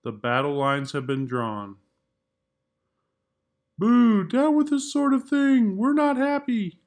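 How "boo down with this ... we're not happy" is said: higher mock protest; protesting crayons. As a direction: chant like a grumbling picket line.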